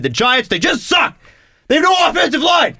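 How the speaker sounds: high, fast mock rant; lightly voiced ranting sports fan; ranting fan impression. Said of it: "was ranting about football".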